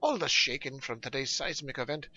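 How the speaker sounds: lively dwarf voice with pitch swoops; enthusiastic dwarf. Acted. Dwarf offering boy an archaeology opportunity, enthusiastic. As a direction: pitch the dig eagerly as a dwarf.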